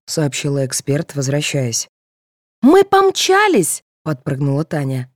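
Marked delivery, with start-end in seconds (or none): 2.6-3.8 high, loud, stretched exclamation; excited. A narrator's reading. Girl rushing off to the final, excited. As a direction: burst out, eager to dash.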